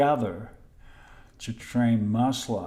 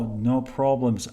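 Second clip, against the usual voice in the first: normal talking voice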